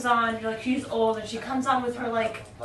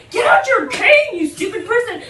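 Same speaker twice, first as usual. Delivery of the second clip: exasperated yell; 9 semitones higher, 10 dB louder than usual. Retold or imitated. Scolding a blind friend on the bus, exasperated yell. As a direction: really yell the order at your friend, half laughing. Retold order repeated, more exasperated.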